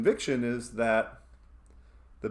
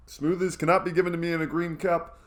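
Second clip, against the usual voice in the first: ordinary delivery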